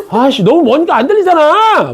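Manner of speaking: high, loud mock complaint; exasperated shout. Teacher to a far-off student he can't hear, exasperated shout. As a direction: yell out in playful exasperation.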